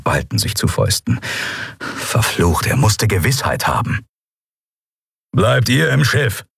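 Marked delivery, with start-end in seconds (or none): 5.3-6.4 slow, firm order; firm command. Acted. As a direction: give the order with no room to argue. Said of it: "befahl er".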